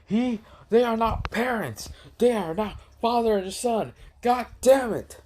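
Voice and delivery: high-pitched exasperated outburst; angry Kakashi. Acted. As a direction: protest furiously in a put-on high voice. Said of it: "got so angry".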